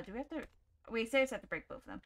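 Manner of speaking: usual speaking voice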